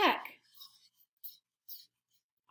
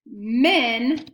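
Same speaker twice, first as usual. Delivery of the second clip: slow, emphatic, louder single word; slow dictation; 5 dB louder than usual. Shown as a demonstration. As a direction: stretch it out firmly for pupils writing. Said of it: "say it again slowly".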